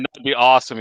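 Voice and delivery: usual speaking voice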